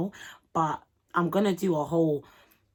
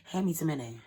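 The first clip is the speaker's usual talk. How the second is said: normal talking voice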